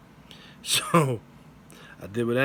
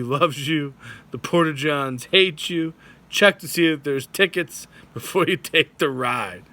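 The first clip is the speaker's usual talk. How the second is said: usual speaking voice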